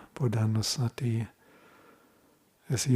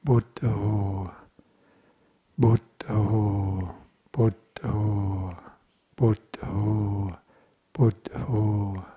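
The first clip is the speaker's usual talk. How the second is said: slow, level repeated mantra with a drawn-out last syllable; mantra repetition; much slower than usual. Shown as a demonstration. Meditation teacher showing a two-syllable mantra, slow repetition. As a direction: repeat it evenly, holding the final vowel. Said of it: "one can simply repeat".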